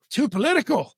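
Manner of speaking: high-pitched disbelieving question; incredulous echo. Retold or imitated. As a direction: throw it back, astonished. Echoes her objection in disbelief.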